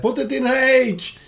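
high, slow mock yell; yelled quote. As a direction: call it out like the Simpsons guy. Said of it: "The guy just yelled out".

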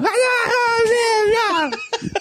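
high pinched whining wail; whiny nasal wail. Retold or imitated. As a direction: wail it high through the nose. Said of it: "What was that?".

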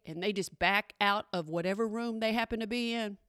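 normal talking voice

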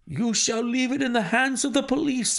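high, overwrought threat; half-mad angry cry. A narrator's reading. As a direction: threaten in high-pitched grief and rage. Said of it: "I cried".

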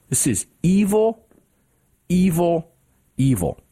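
evil, evil, evil, each hit hard, fading down; emphatic repetition. Real, not acted. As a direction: hammer the word evil three times. Emphatic repeated condemnation.

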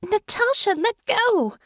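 high, urgent plea to let go; urgent protest. A narrator's reading. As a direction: call out high to a sleeper gripping you. Pinned by dreaming partner, protesting.

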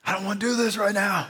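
high, strained tearful protest; high-pitched fake near-crying whine; mock tearing up. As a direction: act out reluctantly welling up, voice pitched high. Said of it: "Like, I'm tearing up".

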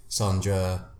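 usual speaking voice

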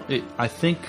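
ordinary talk, nothing marked

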